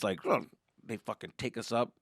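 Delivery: ordinary talk, nothing marked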